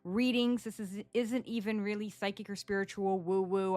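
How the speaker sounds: ordinary delivery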